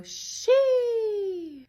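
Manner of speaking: high class-wide meme shriek; students' squeal. Retold or imitated. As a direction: yelp it like a pop-star squeal. Students chant a meme reply.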